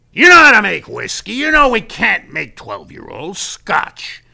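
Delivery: loud, high, punchy pitch; rally a doubtful manager, building to a big finish; emphatic boss laying out the plan; forceful sales talk. Retold or imitated. Acted boss's speech on the phone.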